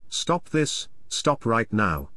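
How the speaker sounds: plain reading voice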